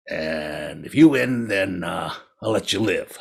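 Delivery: low, slow tiger voice; drawn-out, low big-cat menace; grudging tiger. A narrator's reading. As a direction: offer terms as the predator. Tiger sets the terms.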